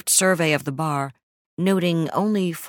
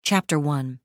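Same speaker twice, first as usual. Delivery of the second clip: ordinary delivery, nothing marked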